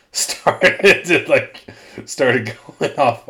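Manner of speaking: loud, broken up by laughter; laughing while talking. Real, not acted. Host owning up to going off kilter, laughing as he talks. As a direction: laugh through the words. Laughing at own failed accent.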